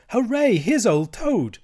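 high, loud, drawn-out cheer; joyful cry. A narrator's reading. Mole greets his returning friend, joyful cry. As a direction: cheer it out high with a beaming face. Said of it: "cried the mole, his face beaming".

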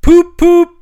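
very high, loud hooted poop-poop; imitated car horn. A narrator's reading. Toad mimicking the motor car he craves, hooting.

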